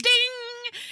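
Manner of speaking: high, loud ringing "ding"; imitated ding. Podcaster mimicking the sound at a smash cut, loud high ding. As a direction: sing out a bell sound.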